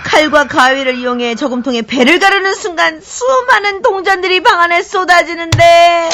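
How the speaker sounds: ordinary delivery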